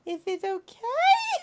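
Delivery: high, drawn-out hopeful plea; exaggerated timid robot-girl plea; pleading question.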